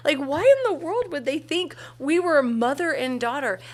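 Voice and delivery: retelling her friend's outrage, voice soaring; mock indignation. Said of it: "I'm like".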